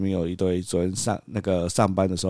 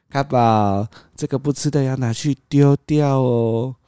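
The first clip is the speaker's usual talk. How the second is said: put-on slow, higher sing-song coaxing a toddler; sweet coaxing; 6 semitones higher, 3 dB louder, much slower than usual. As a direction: gently coax the little one, slow and sweet. (放慢语速，高声哄小孩)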